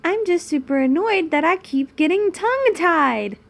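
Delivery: loud, high-pitched mock protest; exaggerated irritation. Reader insists to a viewer she isn't irritated, exaggerated protest. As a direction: swoop up loudly in over-the-top denial.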